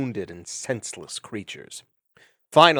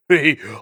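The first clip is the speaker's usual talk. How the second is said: coarse laugh; 4 semitones higher, 9 dB louder than usual. Acted. Vermin laughing.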